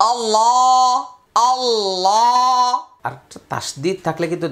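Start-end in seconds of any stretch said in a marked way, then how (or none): 0.1-2.7 "আল্লাহ" with a heavy, full-mouthed lam, held long and higher. Shown as a demonstration. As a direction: say "আল্লাহ" with a thick dark lam, drawn out. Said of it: "এইবার উচ্চারণ কিন্তু".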